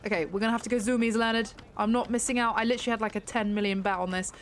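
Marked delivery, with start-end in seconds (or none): none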